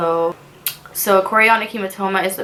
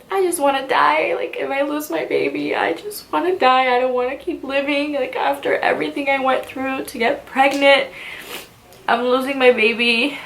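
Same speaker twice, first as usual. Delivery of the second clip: high, tearful and breaking, reliving the panic; desperate crying plea; 6 semitones higher than usual. Retold or imitated. Crying to her mom on the bathroom floor, high and desperate. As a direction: sob it out like your world is ending. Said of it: "I was telling my mom, like".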